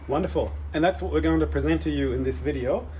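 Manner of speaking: normal talking voice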